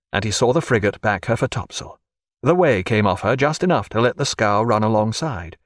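usual speaking voice

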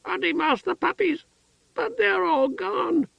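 put-on excited childlike outburst. A narrator's reading. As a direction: blurt it out like a child. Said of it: "then shouted".

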